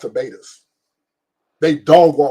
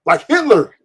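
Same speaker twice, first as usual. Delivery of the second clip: Like Hitler higher and louder, hammered home; podcast host doubling down, louder; emphatic repeat; 6 semitones higher, 4 dB louder than usual. Shown as a demonstration. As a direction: punch it the second time. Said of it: "repeated for emphasis".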